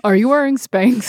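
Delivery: hammed-up airport screener; TSA agent voice. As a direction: play an official confronting a traveler, loudly. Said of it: "We have to speak in the T S A voice".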